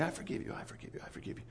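hushed, repeated whisper; whispered reassurance. Retold or imitated. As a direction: whisper it like a private reminder. Voices God's reminders to him.

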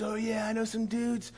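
high, soft, easygoing dude; Mana's dude voice. Retold or imitated. Mana offering his friends to the guy, dude voice. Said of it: "And Mana goes".

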